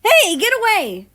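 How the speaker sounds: high, loud scream of alarm; panicked high-pitched yell; frightened shout. Acted. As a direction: yell at the intruder to back off. Intruder reaching for syrup.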